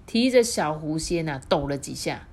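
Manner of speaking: usual speaking voice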